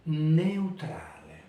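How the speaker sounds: Neutrale slowly, closed e in eu; slow teacher model of Neutrale; Italian diphthong. Shown as a demonstration. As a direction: stretch Neutrale, eu in one syllable. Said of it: "Cette voyelle est fermée".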